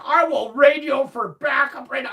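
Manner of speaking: loud, high mock officer threat; officer's threat. The officer threatening to call backup, mock stern.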